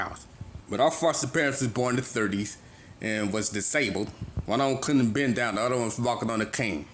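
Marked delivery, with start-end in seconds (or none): none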